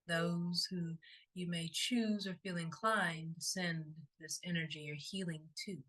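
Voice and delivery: unhurried, soothing and evenly paced; guided meditation. Acted. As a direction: guide them gently into stillness, phrase by phrase.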